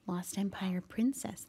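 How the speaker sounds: soft unvoiced whisper. Real, not acted. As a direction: hush it so nobody hears. Sneaking in hiding.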